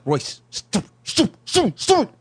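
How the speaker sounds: high, urgent hushed mom; embarrassed wife hissing at her husband; scolding mom. Retold or imitated. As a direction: squeak out mom's repeated stifled scolding. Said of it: "I hear his mom like".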